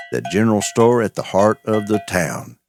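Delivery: usual speaking voice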